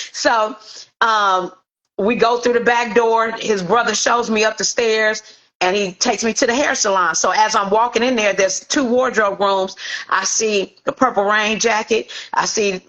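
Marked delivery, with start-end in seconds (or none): none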